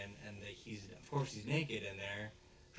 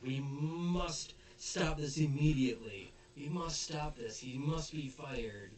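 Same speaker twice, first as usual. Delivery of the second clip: slow, higher, solemn declaration; hammed-up grave determination; mock heroic father voice; dramatic vow; 6 semitones higher, 7 dB louder, slower than usual. Vice principal's dramatic vow.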